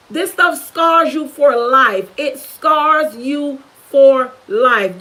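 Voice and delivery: "scars you for life" very slow, word by word; "for life" drawn out and weighted; solemn emphasis. Real, not acted. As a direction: drive home "scars you for life" with pauses. Warning repeated for emphasis.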